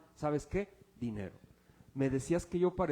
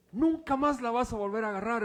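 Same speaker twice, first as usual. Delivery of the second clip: louder, higher-pitched outburst forbidding it; stern scolding; 6 semitones higher, 6 dB louder than usual. Acted. Brother takes the pastor's guitar away, stern scolding. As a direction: act out an indignant brother laying down the law.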